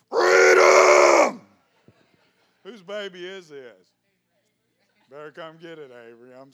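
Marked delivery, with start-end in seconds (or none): 0.1-1.4 much lower, very loud roar; deep roaring shout. Real, not acted. Pastor roaring freedom with the crowd, low and loud. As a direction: roar it from the chest.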